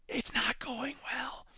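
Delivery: slow conspiratorial whisper; whispered aside. Acted. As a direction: whisper it like bad news. Deadpan aside about robots.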